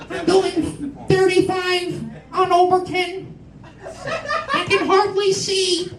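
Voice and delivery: put-on high, slow quavering old lady; old lady driver. Comic mocking a half-blind old lady driver, high and slow. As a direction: play the helpless old driver squinting at the road.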